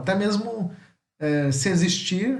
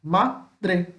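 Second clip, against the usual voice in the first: Madre split into MA, pause, DRE; syllable by syllable; 2 semitones higher than usual. Shown as a demonstration. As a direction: sound out Madre as two separate beats. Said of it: "Tem duas sílabas".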